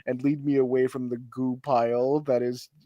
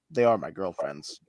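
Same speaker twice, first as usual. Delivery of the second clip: ordinary talk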